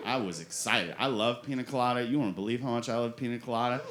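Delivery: ordinary delivery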